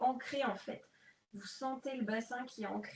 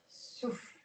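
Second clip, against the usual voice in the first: breathed-out cue; 4 dB quieter than usual. Shown as a demonstration. Instructor cueing her class to exhale, breathy whisper.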